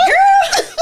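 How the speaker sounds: very high laughing exclaim; squealing laugh. Real, not acted. Guest overwhelmed by the question, squealing laugh. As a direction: squeal with delight.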